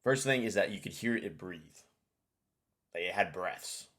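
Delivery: ordinary talk, nothing marked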